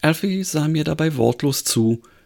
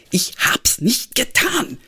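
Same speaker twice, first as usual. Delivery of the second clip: almost shouting; 7 semitones higher, slower than usual. A narrator's reading. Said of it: "schrie er nun fast".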